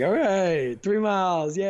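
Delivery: hammed-up finish-line yay; mock cheering. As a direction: celebrate with exaggerated encouragement.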